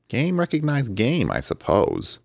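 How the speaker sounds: high, slow, unbothered character voice; cool and sly; cool reply. A narrator's reading. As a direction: say it coolly, almost bored. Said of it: "he replied coolly".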